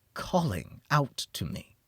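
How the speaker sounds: ordinary delivery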